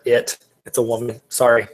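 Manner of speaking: usual speaking voice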